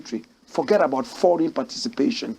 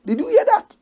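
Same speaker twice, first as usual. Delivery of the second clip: much higher, astonished rising question; amazed question. Real, not acted. Host amazed that Zimbabwe will export wheat. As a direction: sound stunned and delighted.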